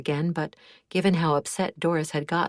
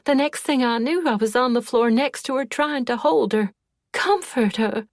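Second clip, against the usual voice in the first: distraught; 7 semitones higher, 4 dB louder than usual. A narrator's reading. Doris recounting Hildy's death, distraught.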